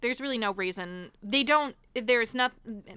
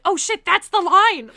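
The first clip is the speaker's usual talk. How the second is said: loud, higher, slow delighted outburst; excited realization; 8 semitones higher, 12 dB louder than usual. Retold or imitated. Friend recalling her reaction to the movie line, loud delight. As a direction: shout it like you just remembered. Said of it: "I died of laughter".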